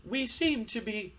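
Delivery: high-pitched, hammed-up aristocrat talking down; haughty snob. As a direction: play a snooty prince pretending to apologize to peasants. Haughty wrestler addresses his former partners.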